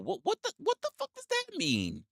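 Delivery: high-pitched confused what-the; baffled outrage. Real, not acted. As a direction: squeak it out in total confusion. Confused by chat's "wrestler pool.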